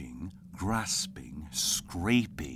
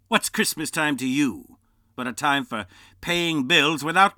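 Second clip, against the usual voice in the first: loud, higher ranting miser; angry rant; 6 semitones higher, 10 dB louder than usual. Acted. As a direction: rail against Christmas. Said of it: "returned the uncle".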